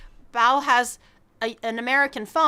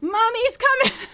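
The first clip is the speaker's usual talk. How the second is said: high, soothing mommy coo; high mock-motherly I'm coming; 12 semitones higher, 4 dB louder than usual. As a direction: play the reassuring mom. Comic reassurance to friend.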